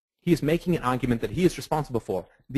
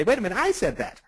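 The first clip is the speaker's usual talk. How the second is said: indignant surprise; 10 semitones higher than usual. Retold or imitated. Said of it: "person A is gonna say".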